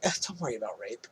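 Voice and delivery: low, soft, fast mock dismissal; imitated offhand reassurance, under the breath; brushing it off. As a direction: toss it away as a trifle. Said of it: "Brushes it off like it's nothing".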